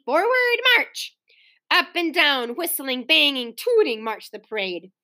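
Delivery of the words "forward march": high, drawn-out marching call; calling a command. A narrator's reading. Mother starts the children's parade, calling out.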